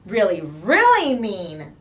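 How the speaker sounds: slow, loud, stressing each "really"; drawn-out "Really, really mean"; emphatic agreement. A narrator's reading. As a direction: pile up the "really"s. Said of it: "they all agreed".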